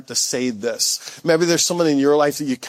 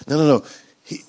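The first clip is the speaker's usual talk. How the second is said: normal talking voice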